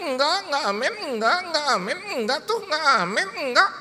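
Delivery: high, loud chanted retort to a preacher; mock refusal. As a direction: throw it back in the preacher's rising cadence. Mock reply echoing preacher's amens. (bantahan meledek bernada tinggi)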